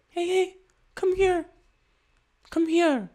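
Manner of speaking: tiny, very high coaxing voice; mock luring call, way up high. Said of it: "be like".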